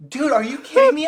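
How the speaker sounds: high, loud disbelief; shouted retold exasperation; re-enacted incredulous yell. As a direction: yell at a reckless friend, appalled. Acting out yelling at reckless friend.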